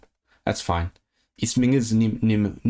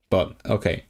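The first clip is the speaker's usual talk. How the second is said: ordinary delivery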